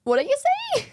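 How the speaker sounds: 高く笑いながら聞き返す; 聞き返す友人の真似を笑いながら; laughing mock confusion. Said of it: "she's like".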